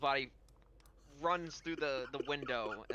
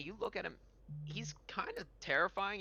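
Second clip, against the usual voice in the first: ordinary talk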